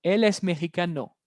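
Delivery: ordinary delivery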